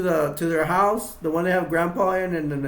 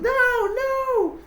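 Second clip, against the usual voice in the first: high desperate no no; panicked cry. Retold or imitated. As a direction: squeal it out in terror. Captive Sally cries out.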